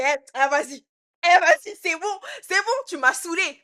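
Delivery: loud exasperated retort at the stepmother; loud fed-up retort. Retold or imitated. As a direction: throw it back at her, fed up and loud. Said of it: "Je fais ça, je fais".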